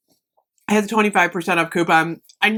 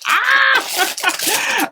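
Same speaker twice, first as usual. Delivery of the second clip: delighted squeal. Real, not acted. Thrilled squeal over candles.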